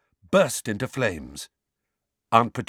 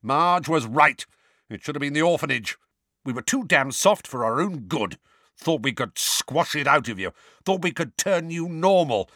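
higher-pitched, bitter uncle's rant; angry uncle; 6 semitones higher than usual. A narrator's reading. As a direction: hammer each grudge home at the boy. Uncle Vernon ranting at Harry.

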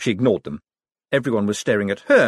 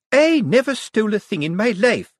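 much higher, louder indignant voice; bridling; 12 semitones higher, 5 dB louder than usual. Acted. Miss Drapes defending herself before the crowd, indignant and high. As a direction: bristle with offended pride. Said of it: "bridling enough for a gymkhana".

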